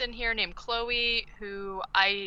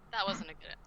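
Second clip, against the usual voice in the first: ordinary talk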